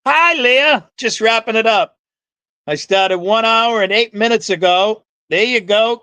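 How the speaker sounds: put-on playful Southern accent greeting Leah. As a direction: lay on a Southern belle accent. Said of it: "Please, there's enough Southern accents here".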